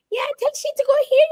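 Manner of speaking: put-on high, quick, sing-song Caribbean island accent; singy-songy island accent. Contrasting other islands with Jamaica, quick sing-song island accent. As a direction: lilt it up fast like a small-island Caribbean accent. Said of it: "whereas the other islands are more ... singy-songy".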